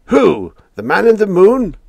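loud, high mocking yell. A narrator's reading. Old man yelling at the radio man, high loud yell. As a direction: bellow the question up high in scorn. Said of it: "yelled the old man".

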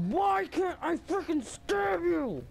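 frustrated whine. Real, not acted. Frustrated during game stab.

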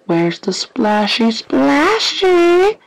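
slow singsong, climbing high at the end; playful searching. Pretend. Gamer hunting whatever made the splashing, playful singsong. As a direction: stretch it out and lift it into a high question.

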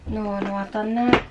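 ordinary talk, nothing marked

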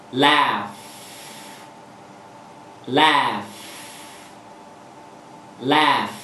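a bright, clear L flicked down into laugh. Shown as a demonstration. Teacher demonstrating the clear L in laugh. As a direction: laugh said with the tongue tip tapping and dropping off the ridge. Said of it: "Chẳng hạn như từ".

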